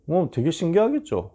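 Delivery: ordinary talk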